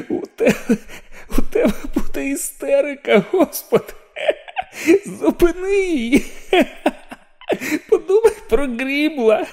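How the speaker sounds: fighting the giggles and losing; high laughing panic; hysterical laughter. Acted. Garraty's inner voice, hysterical laughter.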